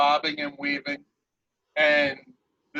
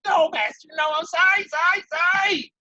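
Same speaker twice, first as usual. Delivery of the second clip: high, loud, groveling imp voice begging; cowering plea; 12 semitones higher, 4 dB louder than usual. Acted. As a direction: beg your master not to hurt you. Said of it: "crouches down and cowers and says".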